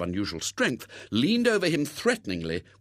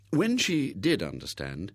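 usual speaking voice

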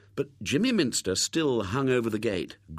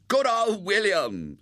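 high, loud, drawn-out cheer; top-of-voice shout; 11 semitones higher, 5 dB louder, slower than usual. A narrator's reading. Jimmy hanging over the gate to William, shouting. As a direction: call it out slowly across a garden. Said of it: "at the top of his resonant voice".

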